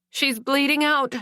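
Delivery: high urgent call; bellowed alarm. A narrator's reading. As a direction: raise the alarm over the patient. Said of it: "my savior bellowed".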